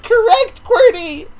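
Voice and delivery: high, loud words through laughter; can barely get it out for laughing. Real, not acted. Laughing while repeating the captcha answer.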